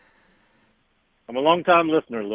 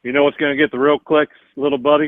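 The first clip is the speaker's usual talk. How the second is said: lightly voiced Elvis giving gentle advice; Elvis impression. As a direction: soothe him like a caring Elvis. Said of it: "this was [name]".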